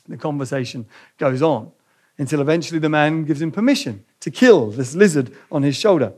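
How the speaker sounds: usual speaking voice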